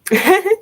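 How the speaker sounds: sudden high burst of laughter; a quick bright laugh. Real, not acted. Laugh alone on a line.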